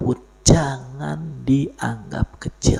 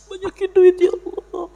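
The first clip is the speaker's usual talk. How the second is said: mock-whining, high-pitched prayer; mock pleading prayer. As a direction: beg for money like an impatient believer. Mocking an impatient money prayer.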